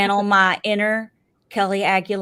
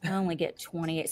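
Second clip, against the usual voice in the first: ordinary talk, nothing marked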